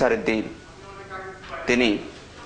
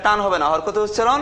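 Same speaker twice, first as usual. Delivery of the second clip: higher, firm correction; correcting the drill; 6 semitones higher than usual. Shown as a demonstration. Teacher correcting a child who stretched the vowel, firmly. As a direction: tell the child not to stretch it. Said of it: "কোন বন্ধু যেন টানতেছিল".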